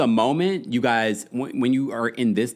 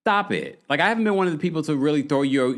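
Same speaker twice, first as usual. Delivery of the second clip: usual speaking voice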